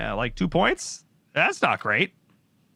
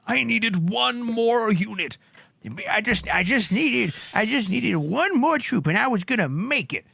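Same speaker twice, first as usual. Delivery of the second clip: high, slow wailing lament; whiny player lament; 10 semitones higher, slower than usual. Pretend. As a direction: wail it as the losing player. Caster voices player's lament.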